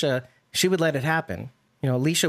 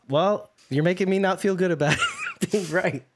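words bubbling with laughter, a bit higher; laughing; 5 semitones higher than usual. Real, not acted. Answering a friend who spoils being right, laughing. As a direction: talk through a laugh.

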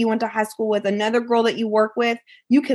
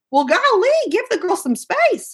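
imitating friends; 12 semitones higher than usual. Friends realizing what she went through, high mock golly. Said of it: "they were like".

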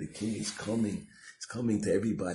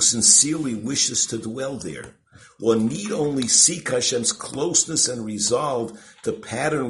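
loud, raised formal recitation; emphatic reading aloud; 5 semitones higher, 9 dB louder than usual. Real, not acted. Teacher reads the commentary to listeners, emphatic and loud. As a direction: read it out forcefully to the room.

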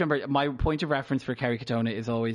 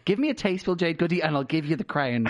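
excited demand; 6 semitones higher than usual. Acted. Mock demand to drag judges.

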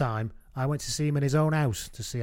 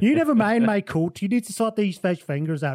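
higher, louder, insistent demand; indignant retort; 7 semitones higher, 5 dB louder than usual. Retold or imitated. Humphries to Sale man about fish fingers, indignant. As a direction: cut him off with an indignant demand. Acted flustered demand to teammate.

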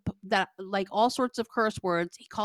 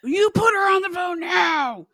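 high, loud imitated yell; mock screaming; 9 semitones higher, 5 dB louder than usual. Enraged caller demanding to speak to her, retold screaming. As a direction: imitate him screaming an order down the phone. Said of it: "like, screaming".

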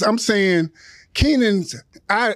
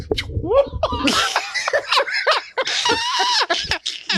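bursts out laughing with a shout; big shout of laughter; 4 dB quieter than usual. Real, not acted. Laughs after the story.

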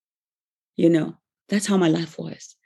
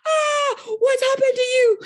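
shocked cry. Retold or imitated. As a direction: squeal it out, stunned by the hug. Girl shocked by sudden hug.

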